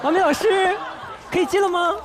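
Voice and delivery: high, exaggerated mock-polite request; sarcastic mother. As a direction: imitate mum needling with false deference. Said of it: "但是她会阴阳我".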